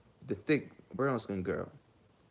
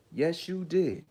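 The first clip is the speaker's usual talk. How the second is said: higher, louder, pointed "Yes, you did."; heated scolding; 6 semitones higher, 4 dB louder, slower than usual. Real, not acted. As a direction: jab "did" down hard like catching a liar. Scolding the accusers directly.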